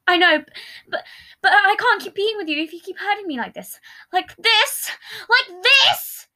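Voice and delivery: high, rising, loud outburst; yelling. Acted. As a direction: yell it, voice cracking up. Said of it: "Patton yelled it out".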